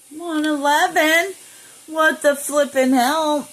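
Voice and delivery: high, loud, slow exasperated cry; exasperated outburst. Real, not acted. Reacting to the time she sees, a loud, slow exasperated cry. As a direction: drag it out loud and high in disbelief.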